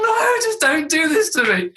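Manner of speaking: high, slow wail through laughter; laughing despair. Retold or imitated. Dad's thought as daughter asks in the loos, laughing despair. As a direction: plead helplessly while laughing.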